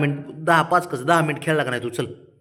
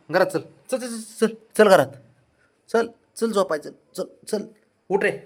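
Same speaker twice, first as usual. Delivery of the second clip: much higher sing-song coaxing call; 10 semitones higher, 3 dB louder than usual. Real, not acted. Owner coaxes Brownie indoors, high sing-song call. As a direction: coax the dog in with a high pitched call.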